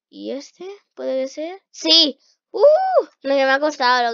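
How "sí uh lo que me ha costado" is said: loud joyful yes; relieved cheer. Real, not acted. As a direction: cheer after a long struggle. Finally beats the puzzle. (grito de alivio)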